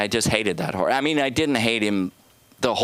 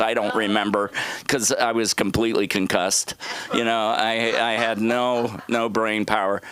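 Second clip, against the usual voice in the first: normal talking voice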